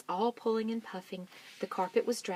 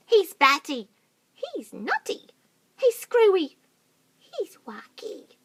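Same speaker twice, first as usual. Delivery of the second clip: very high, loud mocking list; roly-poly bird teasing Mugglewump; 13 semitones higher, 10 dB louder, slower than usual. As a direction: tease like a silly bird. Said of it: "cried the roly-poly bird".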